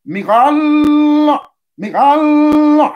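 "Michael" as "Migaaalll", high and loud; ritual pronunciation. Shown as a demonstration. Teacher repeating the name to be copied, "Michael" chanted as "Migaaal". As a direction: intone "Michael" as "Migal" with a long held, high ending. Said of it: "mais on dit".

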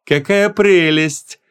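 high, very slow, honeyed cooing; sugary delight. A narrator's reading. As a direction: coo in a put-on sweet voice, stretching it out. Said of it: "с очень сладеньким голосом". (слащавое высокое протяжное восхищение)

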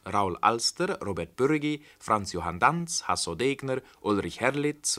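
ordinary talk

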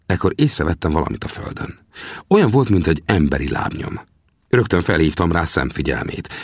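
usual speaking voice

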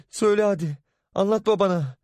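high, pushy urging; mother making daughter tell father. Acted. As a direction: push her to confess.

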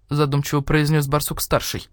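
plain reading voice

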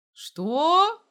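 loud, high, rising disbelief; shocked. A narrator's reading. Dima learns who the raiders are, shocked. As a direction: blurt it out in astonishment.

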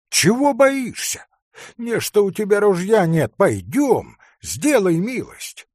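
high, loud coaxing and pressing; urgent urging. A narrator's reading. Hunter urges forester to come help, loud and urgent. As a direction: press him insistently, rising to a cry.

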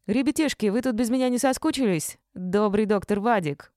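high, loud, jolly call; bright high entrance shout; cheerful greeting. A narrator's reading. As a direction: burst in cheerfully on the kids. Vadik bursts out cheerfully.

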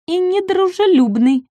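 high, disapproving remark; little dinosaur grumbling. Acted. Ком обиженно добавляет. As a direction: add it with a pout.

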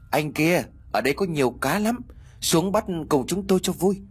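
high, shrill ghostly call. Acted. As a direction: lure him in with an eerie high voice. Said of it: "một cái giọng nói the thé".

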